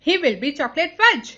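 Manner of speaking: high, loud cry of alarm; acted shriek. A narrator's reading. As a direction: shriek it out as an outraged mother. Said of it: "shrieked Missus Gloop". (ऊँची आवाज़ में चीखते हुए)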